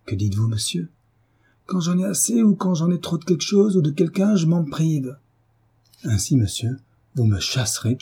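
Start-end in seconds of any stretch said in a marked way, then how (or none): 1.7-5.2 high-pitched overbearing declaration; blustering husband's voice. A narrator's reading. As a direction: lay down the law, pitched up. Brutish husband lays down his rule.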